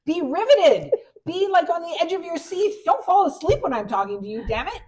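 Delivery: high, loud mock demand; hammed-up rant at husband; mock outburst. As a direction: scold him over the top, half joking. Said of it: "I'm like".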